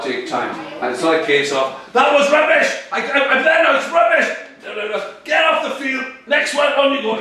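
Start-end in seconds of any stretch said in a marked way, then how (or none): 1.9-7.2 high, loud angry touchline rant; hammed-up furious coach shouting; berating coach. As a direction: tear into a player and send them off. Acts impatient coach scolding, as a bad example.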